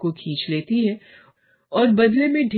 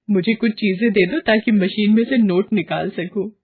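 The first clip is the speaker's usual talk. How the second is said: greedy excitement; 2 semitones higher than usual. Acted. Varsha dismissing her husband, giddy about shopping. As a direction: brush off the warning, thrilled with money. Greedy wife brushes off warning.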